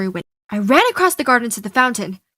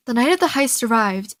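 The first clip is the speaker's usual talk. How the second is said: ordinary delivery, nothing marked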